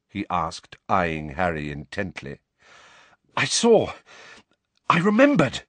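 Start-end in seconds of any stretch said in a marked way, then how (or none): 3.4-5.6 breathless, higher, louder; panting. Acted. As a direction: gasp it out, winded. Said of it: "Harry panted".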